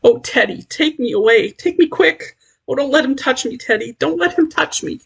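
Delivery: high, pleading and frightened; a hint of sobbing terror, clinging to Teddy; sobbing plea. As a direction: beg him to take her away. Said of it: "Sobbing, shaking".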